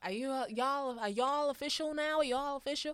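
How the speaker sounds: lightly put-on American accent; attempted American accent. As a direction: try out an American twang. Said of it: "Your American, you can get arrested with that accent".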